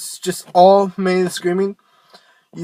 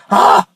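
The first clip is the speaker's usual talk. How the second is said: loud angry ah; angry scream demo; 9 dB louder than usual. Shown as a demonstration. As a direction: bark it out angrily from the gut. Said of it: "Use your angry voice".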